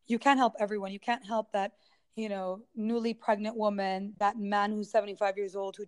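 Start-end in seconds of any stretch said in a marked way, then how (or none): none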